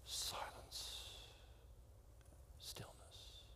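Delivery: a soft, faint whisper; a barely audible whisper. Acted. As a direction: hush the room with each word. Preacher evokes silence and stillness.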